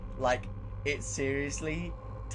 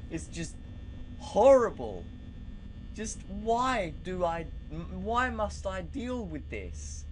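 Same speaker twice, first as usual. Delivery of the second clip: scared whining; 7 semitones higher, 4 dB louder than usual. Real, not acted. Scared night guard player whining about the tension. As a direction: whine it out, genuinely rattled. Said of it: "I'm fucking scared".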